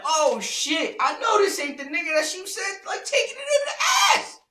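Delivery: loud, rising to a high squeal; shocked realization. Retold or imitated. Man realizing who the rival is, shocked squeal. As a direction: build to an exaggerated high-pitched shriek. Acts out his shocked thought.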